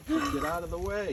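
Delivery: high, loud, slow shoo; teasing get out of the way. Real, not acted. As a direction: playfully yell them out of the way. Shooing someone off playfully.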